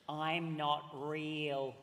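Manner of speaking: very slow, low spooky line; slow eerie reveal. Acted. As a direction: drag it out like a ghost.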